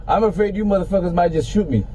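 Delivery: ordinary delivery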